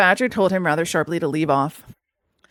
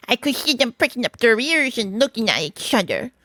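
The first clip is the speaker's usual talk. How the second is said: pleased Mole; 2 semitones higher than usual. A narrator's reading.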